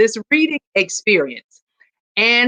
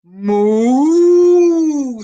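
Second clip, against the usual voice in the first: a long, louder cow moo; 7 semitones higher, 5 dB louder than usual. Acted. Cow answers Duck's greeting, a mooing cow call.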